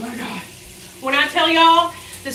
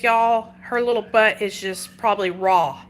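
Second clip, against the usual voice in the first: usual speaking voice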